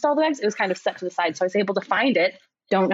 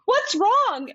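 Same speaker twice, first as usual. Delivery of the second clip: alarmed flight attendant; 12 semitones higher than usual. Retold or imitated. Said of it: "they're, like, super alarmed".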